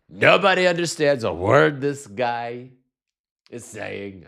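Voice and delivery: Trump voice. Retold or imitated. Host mocking Trump to viewers, blustering Trump voice. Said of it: "my Trump voice".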